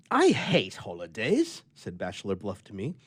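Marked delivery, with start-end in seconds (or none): 0.1-1.6 a touch of irritation, slow and louder; irritated. A narrator's reading. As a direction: grumble it out, mildly annoyed. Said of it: "with some little irritation".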